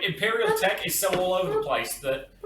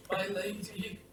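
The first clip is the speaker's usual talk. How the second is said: higher, strained navigator voice; 5 semitones higher, 5 dB quieter, slower than usual. Acted. Pilot reporting to the lady over the vox, strained. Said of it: "I can do the navigator voice".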